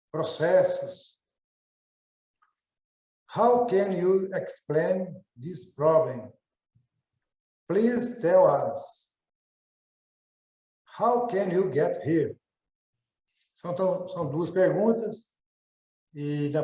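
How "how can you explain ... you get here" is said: slow reading. Shown as a demonstration. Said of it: "student reads examples slowly".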